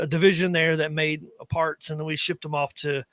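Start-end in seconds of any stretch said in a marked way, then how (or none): none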